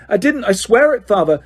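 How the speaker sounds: terrified son swearing innocence; panicked pleading. A narrator's reading. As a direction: beg not to be sent back. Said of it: "shrieked the boy".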